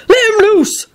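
high, urgent command; growled order. A narrator's reading. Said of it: "growled Hagrid".

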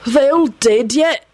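high, irritated question; irritable. A narrator's reading. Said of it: "he asked irritably".

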